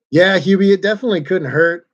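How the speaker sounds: hearty yes to a friend in chat; excited agreement. Real, not acted. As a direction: jump in with real enthusiasm. Answering a viewer's comment enthusiastically.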